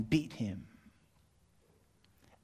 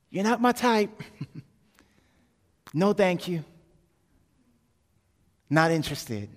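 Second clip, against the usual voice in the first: higher, louder mock brush-off; dismissive rejection; 6 semitones higher, 6 dB louder than usual. Woman rejecting Jesus's advance, dismissive. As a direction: turn down a suitor, pitched up and curt.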